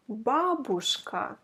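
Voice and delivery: ordinary talk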